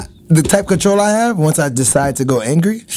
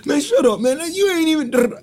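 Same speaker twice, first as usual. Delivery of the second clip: high, brushing-off banter; mock argument; 10 semitones higher than usual. Arguing with his homeboys, high mock annoyance. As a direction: wave your friend off mid-argument.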